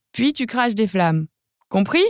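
ordinary delivery

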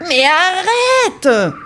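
put-on high, slow, drawn-out girl's protest; a small girl pushing an animal away, stretching the words; little girl protesting, high and slow. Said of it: "proteste la petite fille".

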